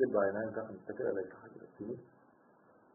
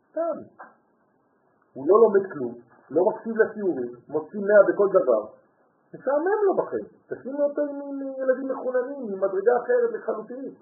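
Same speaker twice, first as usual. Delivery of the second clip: much higher and louder, insistent; heated outcry; 11 semitones higher, 9 dB louder than usual. Real, not acted. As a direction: plead with the mother at full voice. Pleading to the mother, heated. (קריאה נלהבת, גבוהה וחזקה מאוד)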